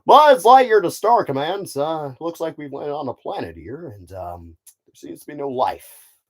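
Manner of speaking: hero's mission report, loud opening that settles down; Buzz Lightyear. Acted. Space ranger reports to Star Command from a planet, heroic role-play. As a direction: announce it like a space ranger, then ease off. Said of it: "he's gonna be a great Buzz Lightyear".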